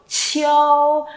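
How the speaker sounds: drawn-out "chiêu" with an airy burst on ch; aspirated initial. Shown as a demonstration. Teacher repeats for class, "chiêu" with aspirated onset. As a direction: puff air into the ch of "chiêu". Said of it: "mình bật hơi nhé".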